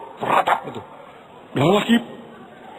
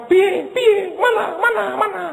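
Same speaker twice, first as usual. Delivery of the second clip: impatient nagging. Acted. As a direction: nag God impatiently, pitched way up. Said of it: "Di depan Raja tuh nggak boleh cerewet".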